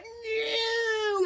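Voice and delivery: long, high wail; drawn-out cry, as if drifting off; mock fading scream. He's flying away from her, mock wail.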